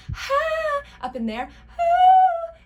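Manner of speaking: very high, loud head-voice hoots; high register demo. Shown as a demonstration. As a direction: flip way up into the top of the voice. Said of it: "She uses a lot of that higher register".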